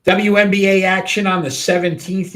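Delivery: sports host kicking off the segment; announcer voice. Acted. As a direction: announce the league like a broadcaster. Host announcing next game.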